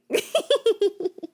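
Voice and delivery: high-pitched giggle; tickled, squeaky laugh. Real, not acted. Finds pretending to be annoyed funny, high giggle.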